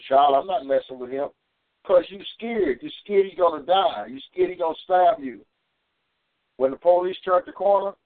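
normal talking voice

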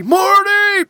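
high frantic shout; Doc Brown yell. Retold or imitated. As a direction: shriek it like the movie. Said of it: "Do you remember this in the movie?".

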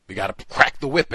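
a touch of a slave-driver's order; mock overseer. Mimicking a plantation overseer.

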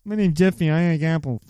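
loud goofy film-character voice; Johnny Knoxville. Pretend. Quoting The Ringer, loud put-on character voice. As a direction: belt it out as a comic movie character. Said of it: "Johnny Knoxville's like".

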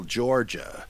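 ordinary delivery

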